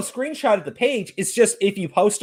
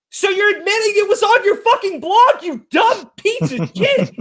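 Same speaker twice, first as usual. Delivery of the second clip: incredulous outburst; 11 semitones higher than usual. Real, not acted. Ranting about the streamer to his guest, outraged and much higher. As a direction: spit it out in outraged disbelief.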